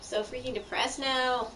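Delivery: ordinary talk, nothing marked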